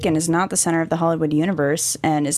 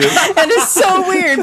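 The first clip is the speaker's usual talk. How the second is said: laughing; 10 semitones higher, 4 dB louder, slower than usual. Real, not acted. Laughing at the impression.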